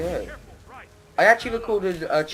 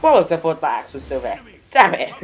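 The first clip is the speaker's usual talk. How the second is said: frustrated outburst; 3 semitones higher, 11 dB louder than usual. Real, not acted. Frustrated at a missed item.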